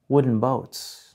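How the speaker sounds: normal talking voice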